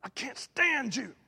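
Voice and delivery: a far, cold "I hate you"; voicing someone lashing out; hateful quote. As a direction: spit it out like you mean it.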